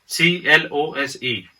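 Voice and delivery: सी एल ओ एस ई spelled slowly, letter by letter. Shown as a demonstration. Teacher spells close for students, slowly. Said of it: "जैसे word है close".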